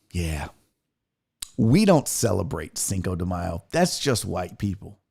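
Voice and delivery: low, quiet deadpan quote; deadpan retort. Retold or imitated. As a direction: imitate an unimpressed sister-in-law. Quoting sister-in-law's deadpan correction.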